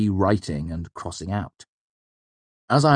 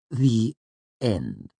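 two words set apart, falling to finish; slow, condescending; 5 semitones higher than usual. A narrator's reading. Clerk ends the argument, slow and condescending. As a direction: close the matter with a pause between words. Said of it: "as if explaining to a simpleton".

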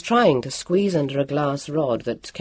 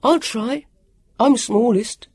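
lightly voiced higher character, eager; eager volunteer; 8 semitones higher, 4 dB louder, slower than usual. A narrator's reading. Sam volunteers for danger.

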